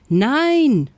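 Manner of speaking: high, loud drawn-out no; emphatic refusal. A narrator's reading. Hippo refuses to let the lion in, emphatic. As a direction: cry out a firm no.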